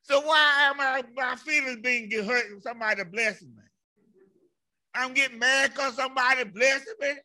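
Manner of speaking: fired-up preacher, punching the key words loudly; full-force preaching. Acted. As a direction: drive each phrase home to the congregation.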